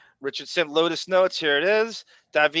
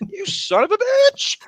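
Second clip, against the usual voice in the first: very high, loud squawk of indignation; mock outrage; 8 dB louder than usual. Host cursing wise-ass Brendan, mock outrage. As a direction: blow up in sudden comic fury.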